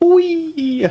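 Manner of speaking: high delighted wee; gleeful squeal. Pretend. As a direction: squeal with joy. Gleeful bouncing creature cry.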